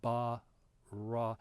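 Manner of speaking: ba and ra read slowly, each syllable pulled apart; sounding out syllables. Shown as a demonstration. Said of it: "I said it was an ah as in father".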